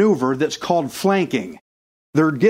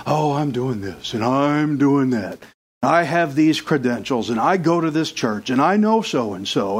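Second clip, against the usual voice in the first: smug churchgoer, lower, mocking; mocking pious voice; 5 semitones lower than usual. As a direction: parody a self-satisfied believer, low. Mocking over-spiritual people.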